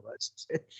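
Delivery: hushed whisper of disgust; mock dismay. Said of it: "you're admitting these things and going".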